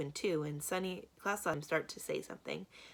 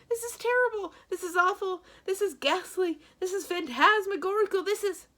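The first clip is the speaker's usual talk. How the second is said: very high, much louder frantic rant; a banker hysterically calling for help; panicked high-pitched babbling; 12 dB louder than usual. A narrator's reading. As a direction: babble in a squeaky frenzy. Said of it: "began leaping and babbling again".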